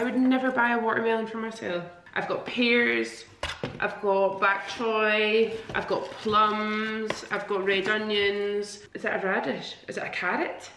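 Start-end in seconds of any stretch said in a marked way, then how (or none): 2.1-8.8 slow list. Real, not acted. Slow showing off box contents.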